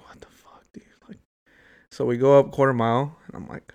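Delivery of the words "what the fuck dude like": whisper. Retold or imitated. Retelling inner panic on hill.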